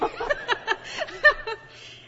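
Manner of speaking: high bright laugh. Real, not acted. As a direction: laugh out loud. Laughs at husband.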